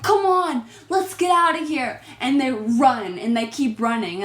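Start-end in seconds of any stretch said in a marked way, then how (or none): 0.0-2.0 put-on urgent boyfriend. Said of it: "he's like".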